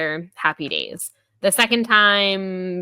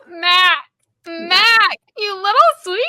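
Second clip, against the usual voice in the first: put-on much higher and louder, cooing at a cute boy; mock cooing; 12 semitones higher, 6 dB louder than usual. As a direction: gush over him like a puppy. Cooing over a sweet boy.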